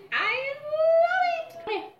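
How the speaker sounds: long high ecstatic cry. Real, not acted. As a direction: stretch it up high in delight. Drawn-out high exclamation.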